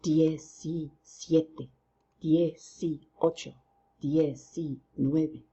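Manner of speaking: diecisiete, dieciocho said slowly, syllable by syllable; slow, careful classroom count; slow counting drill. Shown as a demonstration. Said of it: "let's go ahead and try that".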